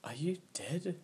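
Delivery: high, hushed, rising whisper; soft whispered question, lifting at the end. A narrator's reading. Mina goes on whispering her questions.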